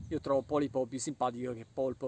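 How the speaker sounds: ordinary delivery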